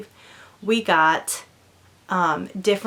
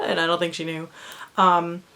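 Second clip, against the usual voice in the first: ordinary talk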